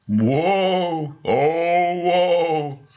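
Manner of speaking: loud eerie lament from beyond; ghostly woe. Acted. Ghost lamenting to the meeting, eerie wail. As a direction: wail your woe like a lost soul. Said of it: "repeated the ghostly voice".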